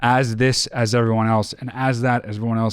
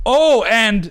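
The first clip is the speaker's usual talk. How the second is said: loud, higher-pitched barge-in; blunt interruption; 9 semitones higher, 5 dB louder than usual. Shown as a demonstration. Host shows the rude way to interrupt, loudly barging in. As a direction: burst in loudly with no apology. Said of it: "You're not just like".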